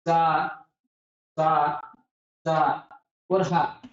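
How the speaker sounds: "सा, सा, सा और हा" as separate sounds, each held with pauses between; teacher demonstrating "सा, सा, सा और हा" as isolated long syllables; pronunciation demo. Shown as a demonstration. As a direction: sound out "सा, सा, सा और हा" one syllable at a time. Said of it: "उच्चारण करके देखिए पहले इन चारों सदस्यों का".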